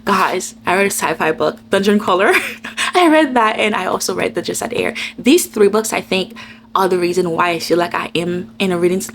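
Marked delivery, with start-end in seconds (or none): 2.4-2.9 quiet airy chuckle; soft breathy laugh. Real, not acted. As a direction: laugh softly at yourself. Laugh after naming sci-fi book.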